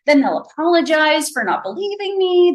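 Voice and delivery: plain reading voice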